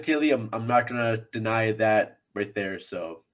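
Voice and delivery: ordinary talk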